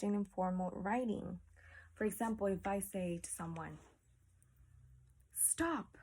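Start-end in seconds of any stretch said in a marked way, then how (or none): 5.3-6.0 sharp high command; commanding stop. Shown as a demonstration. Teacher demonstrating a command to the class, sharp and high. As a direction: order them to stop, up high. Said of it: "that's not a complete sentence, but I'm saying".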